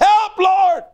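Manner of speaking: high, loud desperate cry; yelled plea. Retold or imitated. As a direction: yell it out in terror. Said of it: "like when somebody yells out".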